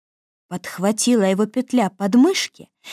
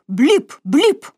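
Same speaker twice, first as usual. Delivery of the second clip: blip sound effect; 5 semitones higher, 3 dB louder than usual. A narrator's reading. Sound of stick blows.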